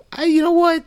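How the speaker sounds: high exclamation. Real, not acted. Sudden high emphatic exclamation.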